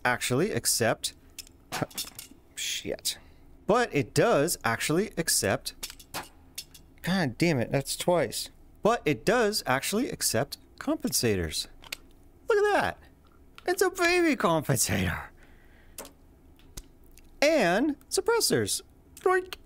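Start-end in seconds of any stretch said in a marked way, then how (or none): 2.5-3.2 breathy muttered swear under breath; muttered curse. Real, not acted. Flubbed line, muttered curse. 12.5-19.6 high cooing over something tiny; cutesy high voice. Pretend.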